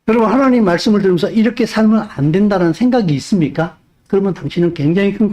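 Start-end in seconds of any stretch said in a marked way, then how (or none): none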